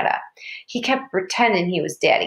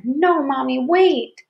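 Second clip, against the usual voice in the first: put-on high-pitched child's protest; alarmed little girl begging Mama; desperate plea; 6 semitones higher, 3 dB louder, slower than usual. A narrator's reading. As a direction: cry out to stop her. Girl blocks mother, pleading.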